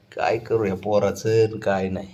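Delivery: ordinary talk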